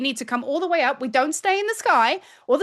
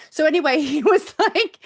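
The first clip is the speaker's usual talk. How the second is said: ordinary talk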